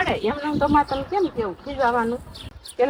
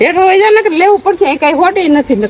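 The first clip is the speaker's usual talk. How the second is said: loud, high-pitched real telling-off; angry scolding; 4 semitones higher, 9 dB louder than usual. Real, not acted. Mother warning her son to get downstairs, angry scolding. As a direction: scold hard, as a threat.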